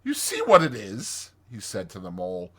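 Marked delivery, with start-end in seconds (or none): 0.0-1.3 raised, exasperated Rat; higher, resigned complaint; despairing Rat. Acted. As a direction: appeal to the Mole, fed up.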